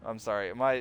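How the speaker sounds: ordinary talk, nothing marked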